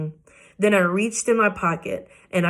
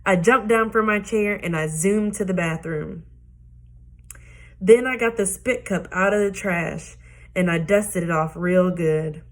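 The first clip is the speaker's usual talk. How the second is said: ordinary delivery, nothing marked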